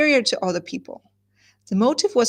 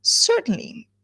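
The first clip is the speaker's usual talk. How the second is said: much higher, mock-sarcastic "Certainly"; 9 semitones higher, slower than usual. Shown as a demonstration. Teacher replaying the husband's sarcastic reply. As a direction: sneer the word in a high mock tone. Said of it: "ignores the sarcasm in his voice".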